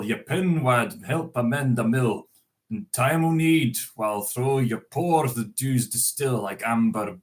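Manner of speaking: reciting Burns in a lightly put-on Scots accent; an unpractised Scots accent, recited; Scots poem reading. Shown as a demonstration. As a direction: read it out like a Burns Night address in Scots. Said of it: "I'm not used to speaking Scots".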